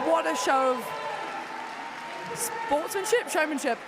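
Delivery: breathless thrilled commentator, almost overwhelmed; excited race call. Real, not acted. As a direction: call the finish like it's on the buzzer. Live race finish, neck and neck.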